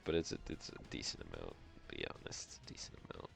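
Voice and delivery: soft, breathy under-the-breath mutter; muttering nervously to himself; whispered aside. Real, not acted. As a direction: whisper it to yourself, worried. Muttering to himself mid-battle.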